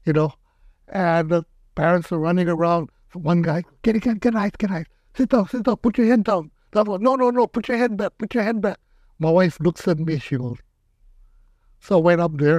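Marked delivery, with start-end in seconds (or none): 3.8-6.5 higher, urgent barked orders; mock a panicking parent shouting directions; rushed mock-frantic commands. 7.0-8.8 higher, insistent no no no; mock-urgent correction; contradicting parent. Second parent contradicting first.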